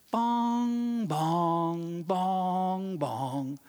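imitated piano notes, long and drawn out; sustained mock piano tones; piano bongs. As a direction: sound out slow, ringing piano bongs, stepping in pitch. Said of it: "I hear".

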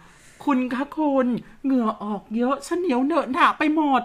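mock genteel lady complaining of the heat; prim Central Thai lady. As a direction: play a refined Bangkok lady fussing about sweat. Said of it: "แปลว่า".

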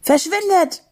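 high-pitched cry to drive them off; shout. Acted. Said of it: "schrie Julebuck".

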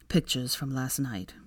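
low, slow, subdued reply; sighing. Acted. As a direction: say it on a mild sigh. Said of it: "Draco sighed".

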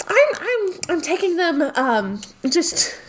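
high, hesitant voice covering a lie; nervous high Spider. Acted. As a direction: stammer it out, high and flustered. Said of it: "And Spider goes".

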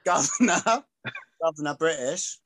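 put-on amateur British accent, laughing at it; mock British accent. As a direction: echo it in a silly British accent. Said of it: "Your British accent is about the same as mine".

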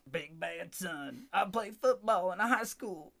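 a hammed-up tough teenage jock; lightly voiced bragging high school jock; mocking the tough son. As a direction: puff up and mock the big bad son.

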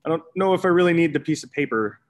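dismissive self-quote. Retold or imitated. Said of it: "so I was like, "Eh".

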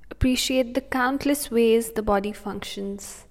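soothing, unhurried breathing cues; calm meditative tone. Acted. Meditation continues.

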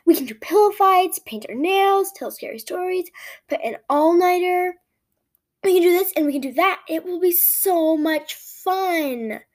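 high, loud, bubbling list of plans; excited gushing. A narrator's reading. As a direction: gush on and on, stretching the final phrase. Kate gushes about the sleepover.

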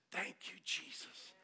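slow, whispered gratitude; hushed thanks. Retold or imitated. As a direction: breathe out a relieved prayer. Retold relief at good news.

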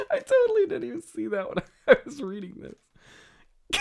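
high giggly voice breaking into laughter; giggling. Real, not acted. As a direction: talk through the giggles. Laughing at a silly line.

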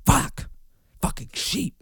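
ordinary talk, nothing marked

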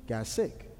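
low, soft understatement; hushed. Acted. Preacher telling that the baby fell sick, hushed.

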